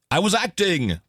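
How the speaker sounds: put-on a light English accent, exclaimed with "was" leaned on; quoting the English voice with a playful English accent. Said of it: "this English accent came out of the speakers".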